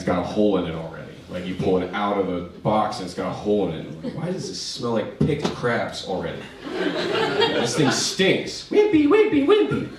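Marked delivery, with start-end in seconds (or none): 4.2-9.9 high, slow disgusted whine. Retold or imitated. Complaining to himself about a torn trash bag, a high whine. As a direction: whine over the flimsy bag.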